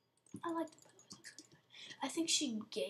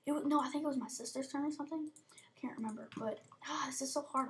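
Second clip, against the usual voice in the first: normal talking voice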